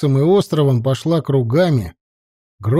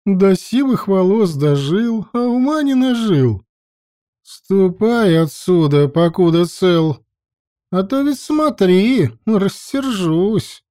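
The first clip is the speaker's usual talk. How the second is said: high, slow scornful warning; mocking threat; 6 semitones higher, slower than usual. A narrator's reading. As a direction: warn him off with scorn. Lokša threatens Vergis.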